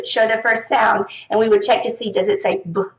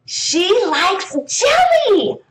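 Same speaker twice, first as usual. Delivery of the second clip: very slow, higher reading voice; 9 semitones higher, much slower than usual. Shown as a demonstration. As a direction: read it out slowly for the class. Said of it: "here's another one for that".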